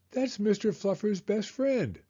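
put-on high-pitched child voice; protesting kid. A narrator's reading. Son defending another toy to mom, high protest. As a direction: plead as the little boy.

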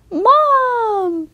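high excited squeal of Mom; cheering it out, very high. Acted. Jackie cheering at his friend's mother, high squeal. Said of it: "Jackie cheered".

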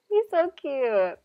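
cooing. Real, not acted. Player gushing over the cute dog professor, slow coo. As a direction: melt over the puppy, slowly.

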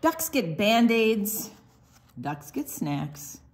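usual speaking voice

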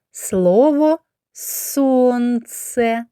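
very slow, clear "Слово місяць"; slow teacher's dictation; slow word naming. Shown as a demonstration. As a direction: sound out the word for children. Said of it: "teacher sounding out the word".

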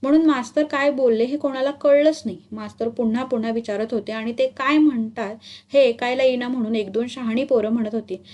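usual speaking voice

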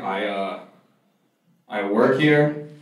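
nervous. Retold or imitated. Caught working at a Russian restaurant, mock-nervous. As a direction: imitate a sheepish friend stalling on an answer. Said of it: "He got, like, visibly nervous".

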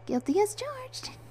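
very high, drawn-out proud admission; slow, high, pleased with yourself; giggly pride. A narrator's reading. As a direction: own up proudly in a high, girlish voice. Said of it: "She giggled and said proudly".

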